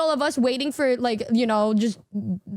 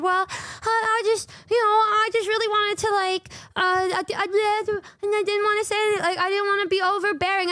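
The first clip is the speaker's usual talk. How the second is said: hesitant, pitched-up imitation of the friend; mock stammering friend; 6 semitones higher than usual. Mimicking the friend stammering her excuse, pitched up. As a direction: stumble through it sheepishly.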